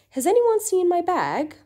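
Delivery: teacher voicing Jennifer's question; acted question. Shown as a demonstration. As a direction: ask it like someone hunting a lost bag.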